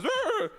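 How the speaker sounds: high, loud growling rev noise; the horse's engine roaring when you gee it up; imitated horse engine growl.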